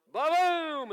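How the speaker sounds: loud booming thunder noise. Acted. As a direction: roar the thunder out.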